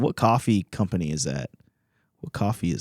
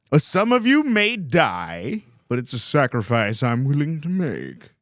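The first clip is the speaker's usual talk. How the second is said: movie villain voice; 5 semitones higher than usual. Retold or imitated. Quotes the Shrek villain line.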